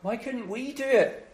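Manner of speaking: high, mildly sheepish question; ashamed. Acted. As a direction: ask as an embarrassed disciple, lightly acted. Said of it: "as though they're ashamed".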